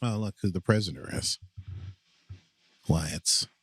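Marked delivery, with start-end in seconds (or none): none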